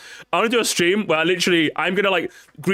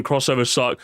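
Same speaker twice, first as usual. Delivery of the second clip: ordinary talk, nothing marked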